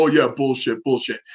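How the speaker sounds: low, dismissive scoff; mocking skeptic. Imitating doubtful viewers, low dismissive scoff. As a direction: sneer it like a doubter. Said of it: "people that will ... go".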